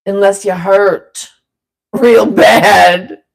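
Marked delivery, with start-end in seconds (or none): 1.9-3.2 laughing. Real, not acted. Laughing at own joke.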